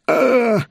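high agonised groan; drawn-out high pained "ah"; acted wail of pain; cry of pain. Villain's shoulder being crushed.

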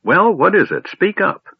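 high, loud impatient snap; snapped. A narrator's reading. Old man snapping at the speechless kender. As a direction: demand an answer, short-tempered. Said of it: "snapped the old man".

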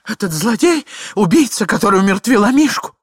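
high, loud wifely wail; tearful shouting. A narrator's reading. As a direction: cry out an accusation in mild tears. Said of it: "кричала супруга, заливаясь слезами". (крик супруги сквозь слёзы)

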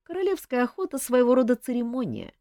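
ordinary delivery, nothing marked

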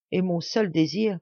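usual speaking voice

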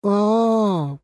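drawn-out letdown sigh; disappointed. A narrator's reading. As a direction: stretch it out, let down. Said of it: "разочарованно протянула Машка". (разочарованно протянуто)

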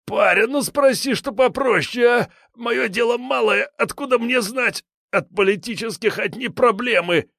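high whiny frightened thug; a cornered petty crook pleading; scared thug voice. A narrator's reading. Gang leader whining.

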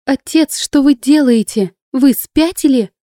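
high, loud, drawn-out protest; crying out. A narrator's reading. Natasha pleading with her father to see reason, crying out. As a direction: cry out in disbelief, pitched well up. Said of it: "кричала Наташа".

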